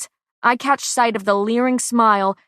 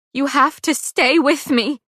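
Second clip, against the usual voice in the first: higher, louder voice cracking; voice breaking; 6 semitones higher, 5 dB louder than usual. A narrator's reading. Pei begs her friend to stay, voice breaking. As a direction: let the voice break on it. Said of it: "My voice breaks".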